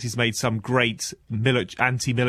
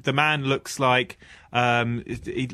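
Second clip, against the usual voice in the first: ordinary delivery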